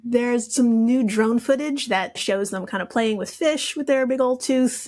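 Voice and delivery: usual speaking voice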